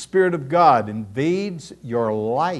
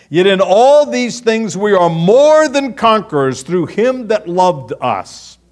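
preaching at full force; 4 semitones higher, 8 dB louder than usual. Acted. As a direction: proclaim the promise to the congregation.

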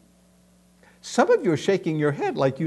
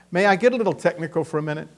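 ordinary delivery

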